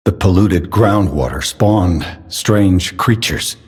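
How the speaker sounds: ordinary talk, nothing marked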